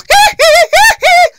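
falsetto "hee-hee" yelps; sharp, very high staccato pop squeals; high-pitched singer's whoop. Shown as a demonstration. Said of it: "I could do the sound of [name]".

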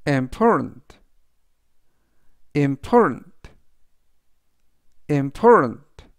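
"important" stressed on POR, im-POR-tant; model pronunciation. Shown as a demonstration. Teacher repeats "important" for students, emphatic and clear. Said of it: "라고 발음하시면 되겠습니다".

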